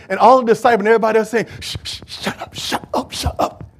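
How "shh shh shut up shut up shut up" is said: hissed unvoiced hush. Disciples hushing the blind man, hissed whisper. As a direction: shush him hard under your breath. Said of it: "trying to tell him to shut up".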